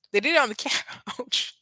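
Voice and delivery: breaking into laughter before the last word; amused laughter. Real, not acted. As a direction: let a laugh split the sentence. Laughing while repeating the scandalous detail.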